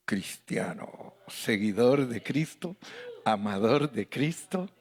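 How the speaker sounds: slow, softened reverent naming; tender savouring. Acted. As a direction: linger fondly on each title. Savouring the now beautiful name.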